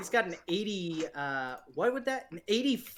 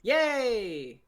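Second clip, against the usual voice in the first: excited cheer; 6 semitones higher, 6 dB louder than usual. Real, not acted. Cheering a failed roll.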